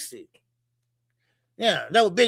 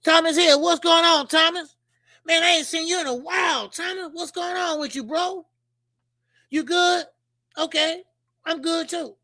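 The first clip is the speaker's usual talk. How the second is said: higher, bright call-out to a friend; excited greeting; 7 semitones higher than usual. Real, not acted. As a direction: greet him like a long-lost friend. Greeting a viewer in chat.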